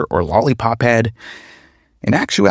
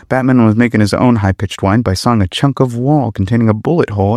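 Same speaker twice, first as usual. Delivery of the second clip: ordinary delivery, nothing marked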